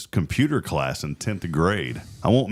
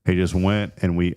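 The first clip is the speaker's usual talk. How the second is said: plain reading voice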